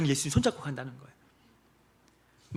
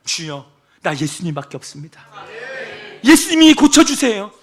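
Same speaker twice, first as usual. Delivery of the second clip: louder, rising prayer cry; fervent prayer; 9 semitones higher, 13 dB louder than usual. Acted. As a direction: cry out to the Lord. Leads congregation's prayer.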